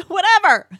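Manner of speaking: laughing it off loudly. Real, not acted. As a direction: blurt it out through a big laugh. Laughing dismissal of host's correction.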